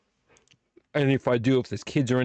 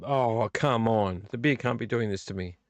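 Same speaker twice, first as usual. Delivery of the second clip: ordinary delivery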